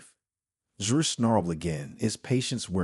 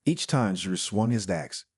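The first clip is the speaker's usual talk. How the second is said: ordinary delivery, nothing marked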